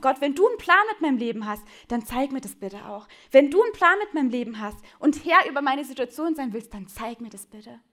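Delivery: loud pleading bursts that sink back at each ending; desperate cry to God. Retold or imitated. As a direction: cry out to God, begging for a sign. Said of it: "wo du geschrien hast".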